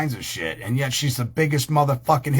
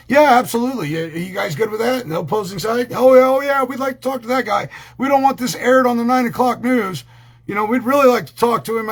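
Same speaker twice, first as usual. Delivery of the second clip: eager opposing lawyer; 9 semitones higher, 4 dB louder than usual. Acted. Voicing judge and opposing side.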